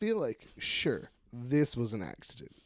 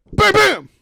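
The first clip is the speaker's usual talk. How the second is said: high, loud double bang; imitated gunshots; 16 dB louder than usual. Host acting out shooting at the door, imitated bangs. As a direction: yelp out two gunshots.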